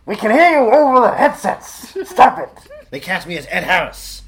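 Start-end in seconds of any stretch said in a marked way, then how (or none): 0.1-2.5 loud complaint. Retold or imitated. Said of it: "They said".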